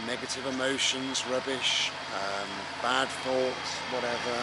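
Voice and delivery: talking over a loud tractor; tractor rumbling behind. Real, not acted. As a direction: raise the voice over engine noise. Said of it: "Sorry about the tractor".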